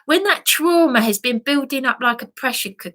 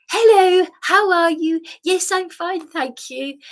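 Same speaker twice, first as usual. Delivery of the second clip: bright polite small talk, a touch higher; stiff upper lip politeness; 6 semitones higher than usual. Retold or imitated. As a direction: put on a cheerful British front. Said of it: "I do the British stiff upper lip".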